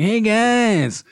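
high, slow preachy mock announcer voice; hammed-up imitation of the speaker at the rally; mocking Spencer Cox. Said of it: "Spencer Cox like".

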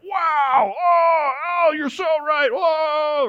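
high, hammed-up gasping wonder; mock-tearful amazement at the pastor; mock dazzled awe. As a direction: gush like a dazzled student, overdone. Mocking awestruck students.

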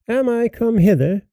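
put-on high, haughty girl's voice; proud and saucy. A narrator's reading. Proud girl answering the disguised fairy, saucy. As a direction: toss it out with saucy pride. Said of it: "said the proud, saucy".

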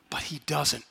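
whispered, dropping to almost nothing; a dramatic whispered aside; hushed whisper. Acted. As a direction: let it fall to a hush. Dramatic hushed turn in sermon.

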